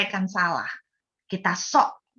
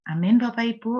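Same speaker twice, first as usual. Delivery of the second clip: ordinary delivery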